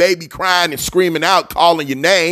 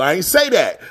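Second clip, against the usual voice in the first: ordinary talk, nothing marked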